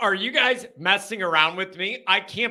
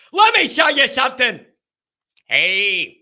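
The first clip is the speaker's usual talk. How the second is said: higher, louder put-on showman voice; hammy Fire Marshal Bill bit; Fire Marshal Bill impression; 6 semitones higher, 5 dB louder than usual. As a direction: play the reckless fire-safety clown. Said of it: "Fire Marshal Bill is".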